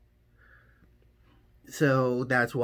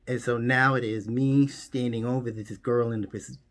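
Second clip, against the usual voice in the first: ordinary talk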